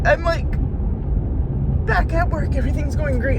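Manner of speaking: breaking down in loud sobs while venting; crying. Real, not acted. Vlogger venting to viewers about her foot injury, crying loudly. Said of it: "I don't mean to cry".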